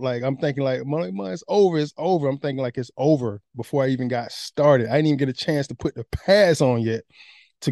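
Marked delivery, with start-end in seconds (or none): 0.9-2.3 panicked thought. Retold or imitated. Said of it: "I'm freaking out".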